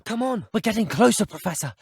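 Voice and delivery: high, urgent shouts to a companion; urgent calling out. Acted. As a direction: call out to hurry them along. Urging the professor onward in action.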